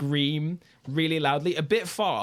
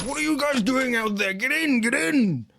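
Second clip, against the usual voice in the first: boatman waving them in; 10 semitones higher than usual. Acted. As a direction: hurry them inside, higher. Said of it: "He's just like".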